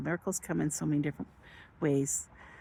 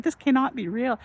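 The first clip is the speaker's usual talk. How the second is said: higher, louder stunned delight; amazed; 8 semitones higher, 5 dB louder than usual. Retold or imitated. Telling viewers about the thousand-dollar win, higher amazed voice. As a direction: still can't believe it. Disbelief at jackpot.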